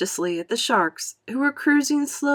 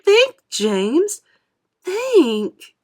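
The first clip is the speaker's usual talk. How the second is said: much higher, louder urgent plea; begging; 11 semitones higher, 6 dB louder, much slower than usual. A narrator's reading. Miss Spider begging James to think. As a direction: beg him to think.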